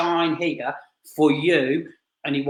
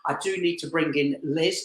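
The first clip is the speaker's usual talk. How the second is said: ordinary talk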